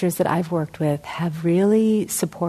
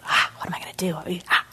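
worried whisper; 5 dB quieter, much faster than usual. Acted. Shopkeeper's worried inner voice.

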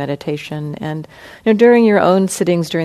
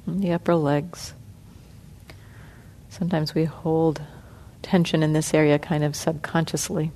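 soft, unhurried meditation guidance with long pauses; guided meditation; 10 dB quieter than usual. Acted. Teacher guiding the sitting group through body relaxation, soft and calm. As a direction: ease the group gently through each part of the body.

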